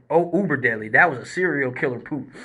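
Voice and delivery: ordinary delivery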